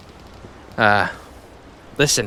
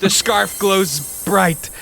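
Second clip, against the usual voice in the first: dramatic narration; 8 semitones higher, much slower than usual. Acted.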